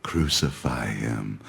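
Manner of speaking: very low, slow, soft demand; drawn-out, quiet menace; low outcry. Acted. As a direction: open the mob's cry deep and hushed. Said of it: "they cried out".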